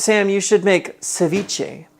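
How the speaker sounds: slow, drawn-out read of the comment; reading a comment slowly. Retold or imitated. Reading Abby's ceviche suggestion to the stream, drawn out. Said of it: "Abby says".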